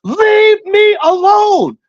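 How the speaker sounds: drawn-out high-pitched yell, acting out a snap; reenacted outburst, loud and very high; retold yell. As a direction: yell it slowly and high, every word stretched. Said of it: "I yelled in his face".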